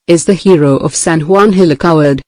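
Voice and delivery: usual speaking voice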